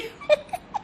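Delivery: a quick high giggle. Real, not acted. Telling fans about her husband, a high giggle.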